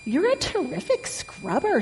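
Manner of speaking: high, sweet piglet compliment; squeaky, pleased piglet. A narrator's reading. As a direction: praise in a high-pitched little pig voice.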